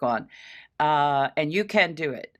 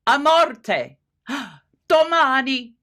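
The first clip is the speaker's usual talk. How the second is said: each phrase louder and higher; building intensity; 9 semitones higher, 8 dB louder, slower than usual. Shown as a demonstration. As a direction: build with each statement, up to the last. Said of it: "each one of those softer building".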